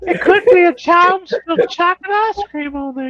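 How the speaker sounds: put-on high-pitched feeble old man voice; Herbert from Family Guy. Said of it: "pretend to be, like, Herbert from Family Guy".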